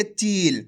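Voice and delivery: चील with a very long, held ee; slow, sustained चील for learners; drawn-out long vowel. Shown as a demonstration. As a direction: stretch the ee right out in चील. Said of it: "खींच के".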